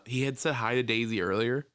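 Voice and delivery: ordinary talk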